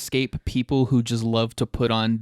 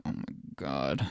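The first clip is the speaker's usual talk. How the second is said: awed reaction; 10 semitones lower, 10 dB quieter than usual. Retold or imitated. Awed reaction to seeing Star Destroyers.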